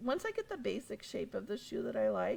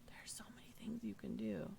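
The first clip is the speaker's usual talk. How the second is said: soft breathy whisper; 7 dB quieter than usual. Shown as a demonstration. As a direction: whisper it like a secret. Same line repeated as whisper.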